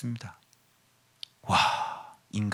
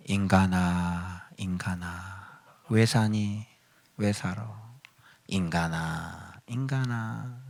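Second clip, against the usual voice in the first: slow lament; 4 semitones lower, 7 dB quieter, much slower than usual. Shown as a demonstration. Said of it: "그 소리 이렇게 바꿔야죠".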